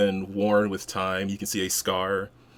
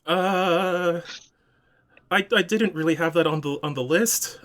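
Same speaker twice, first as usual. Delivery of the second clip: nervous clerk, pitched up and rushed; flustered high voice; 10 semitones higher than usual. Acted. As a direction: fumble the words, high and quick. Flustered NPC facing odd request.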